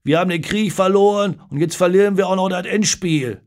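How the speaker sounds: loud, high-pitched lament across a pub; calling out. A narrator's reading. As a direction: call it out in loud dismay. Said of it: "Paule ruft in Christas Eck".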